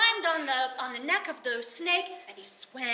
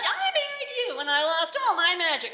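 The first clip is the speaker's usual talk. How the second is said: furious imposter; 5 semitones higher, 5 dB louder, faster than usual. Acted. Imposter storming out in rage.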